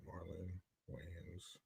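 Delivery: ordinary talk, nothing marked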